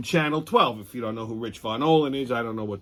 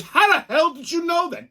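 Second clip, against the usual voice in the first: surprised weatherman. Retold or imitated. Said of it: "He goes".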